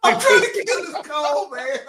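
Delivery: high laughing shout of protest. Real, not acted. Defending himself to the cohosts, shouting high and laughing. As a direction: yell it through the laughter.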